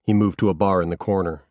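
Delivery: plain reading voice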